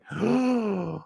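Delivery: a high, sudden gasp of shock; sharp gasp. Retold or imitated. Dreamer gasping as the truth hits him, high startled gasp. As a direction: catch your breath in a startled gasp.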